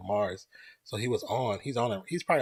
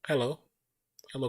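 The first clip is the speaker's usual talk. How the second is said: normal talking voice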